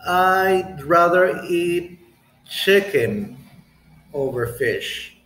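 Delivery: slow and deliberate, each phrase set apart by pauses; slow model answer. Shown as a demonstration. Teacher slowly models ranking chicken, fish and beef. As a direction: lay it out slowly with clear breaks. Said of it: "you can say".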